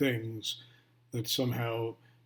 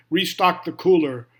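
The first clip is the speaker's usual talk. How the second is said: raised, higher call within a poem; mild narrated shout; 5 semitones higher, 10 dB louder than usual. A narrator's reading. Shopkeeper's wife calls to her husband, mild shout. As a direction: lightly call it out, no full yell. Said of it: "maybe shouts to Mister Jasper".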